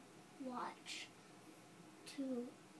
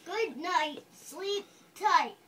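louder, firm scolding parent; angry part; 3 semitones higher, 11 dB louder than usual. Acted. Child reading the parent's angry bedtime scolding, loud. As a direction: lay down the law, fed up. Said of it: "This is the angry part".

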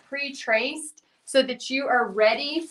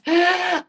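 quick bright laugh; short laugh; 7 semitones higher, 5 dB louder than usual. Real, not acted. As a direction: let out a little laugh. Laughs at her own alarm story.

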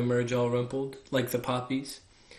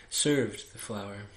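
plain reading voice

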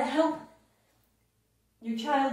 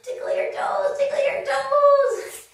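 much higher, giggly tickle voice; playful squeal; 12 semitones higher, 6 dB louder than usual. Pretend. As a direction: tease the kids with a squeaky sing-song. Playful cue to kids.

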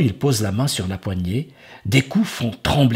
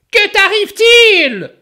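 ogress crying out; 9 dB louder than usual. Acted. Ogress worried.

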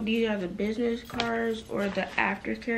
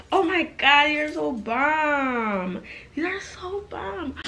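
excited; 6 semitones higher, slower than usual. Real, not acted. Delighted by the new cards.

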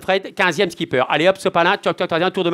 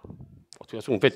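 whispered aside; 10 semitones lower, 11 dB quieter than usual. Real, not acted. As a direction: whisper De toute façon, under the breath. Aside to the players.

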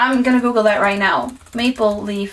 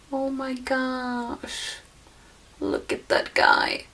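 hushed awe; 8 dB quieter, slower than usual. Real, not acted. Cooing at cute snowman ornament.

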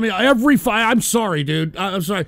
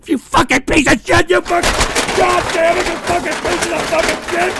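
furious yell; 7 semitones higher than usual. Acted. Staged rage rant at chat.

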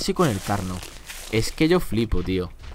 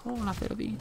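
cooing at piglet; 7 semitones higher, 11 dB quieter than usual. Acted.

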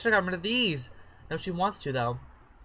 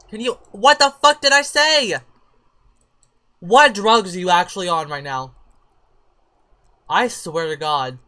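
furious yelling; 2 semitones higher, 13 dB louder than usual. Real, not acted. Player yelling at a sim who won't obey, furious shout. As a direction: yell at the disobedient sim.

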